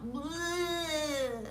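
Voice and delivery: long high-pitched "bleh"; drawn-out sound of mock revulsion; playful exaggerated yuck noise; mock disgust. Kids' taunt of disgust.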